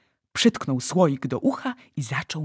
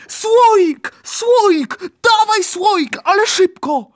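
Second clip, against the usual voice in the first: high, loud, insistent calls; urgent shouted command; 10 dB louder, much slower than usual. Acted. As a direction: shout the order with frantic urgency. Giant urgently demands the jar.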